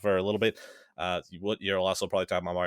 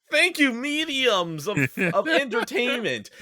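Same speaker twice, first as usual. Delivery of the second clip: high, loud, drawn-out mock gratitude; hammed-up, squealed thank-you, stretching the words; exaggerated relief; 13 semitones higher, 8 dB louder, slower than usual. Host thanks co-host for carrying them, loud mock relief.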